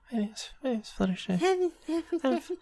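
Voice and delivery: high, soft and timid; a shy, squeaky little voice; timid Fluttershy voice. Retold or imitated.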